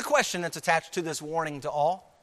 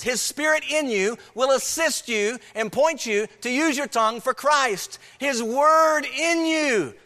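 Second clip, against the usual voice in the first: raised, ringing exhortation with weighted key words; emphatic preaching; 4 semitones higher, 4 dB louder, slower than usual. Real, not acted. Preacher urging congregation to use the tongue for Christ, fervent. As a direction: preach it with force, stretching the important words.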